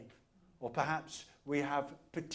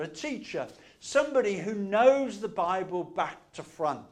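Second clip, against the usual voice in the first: loud, driving sermon delivery; preaching at full force; 3 semitones higher, 8 dB louder than usual. Acted. Preacher retelling Luke's Gospel to his church, loud and driving. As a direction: proclaim it to the whole congregation.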